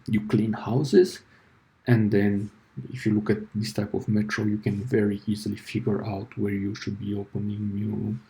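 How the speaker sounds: usual speaking voice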